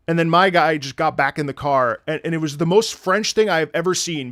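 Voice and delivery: usual speaking voice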